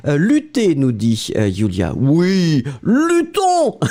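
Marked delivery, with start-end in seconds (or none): 2.0-3.8 high, rallying "luttons!" like a call to arms; rally the class, playfully; mock battle cry. Teacher reads verb as rallying cry.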